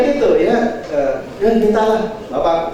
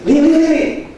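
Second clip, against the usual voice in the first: high, urgent repeated call; 10 semitones higher, 4 dB louder than usual. Retold or imitated. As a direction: call your wife over from another room. Calling his wife from the bath. (memanggil istri dengan nada tinggi)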